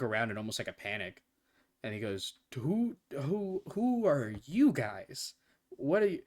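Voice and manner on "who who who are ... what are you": panicked. Acted. Cursed king voiced in panic.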